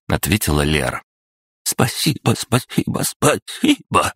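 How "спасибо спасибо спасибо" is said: high voice breaking into a wheeze; rattled off hoarsely. A narrator's reading. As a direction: gabble thanks, voice cracking. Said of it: "протараторил Филимонов, сбиваясь на хрип".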